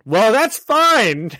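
sotaque americano imitado, agudo e muito lento; American accent. Retold or imitated. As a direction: arrasta o sotaque americano de caubói. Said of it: "do his American accent".

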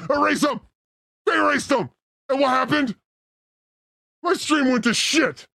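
angry rant. Real, not acted. Rage at StreamYard.

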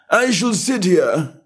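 high and slow, drawn out; slow footman. A narrator's reading. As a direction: settle in without hurry. Footman repeats with variations.

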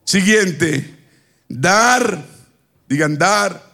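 ordinary talk

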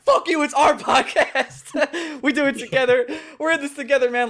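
half-laughing mock rant about whose podcast it is; retold flip-out. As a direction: ham up yelling at your co-host. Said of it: "I flipped out".

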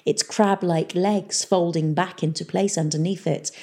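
usual speaking voice

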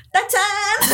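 loud, high ta-da; loud, high mock fanfare; triumphant ta-da. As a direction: present yourself like a magic trick. Drunk grand reveal at door.

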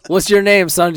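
put-on a cop quizzing a nervous kid; mock cop. Reservation cop asking the friend his name, mock cop voice.

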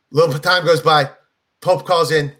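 ordinary talk

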